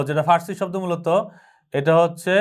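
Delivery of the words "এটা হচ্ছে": normal talking voice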